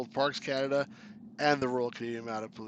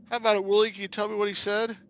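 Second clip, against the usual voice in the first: high and loud asking; loud call; 7 semitones higher, 7 dB louder than usual. Acted. Asking Willie to translate, high and loud. Character asks loudly.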